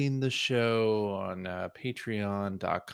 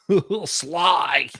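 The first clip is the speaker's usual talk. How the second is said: slow, higher lisping character voice; lispy voice; 5 semitones higher, 4 dB louder, slower than usual. Pretend. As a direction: lisp it out slowly as Sly. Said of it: "Getting a little too lispy".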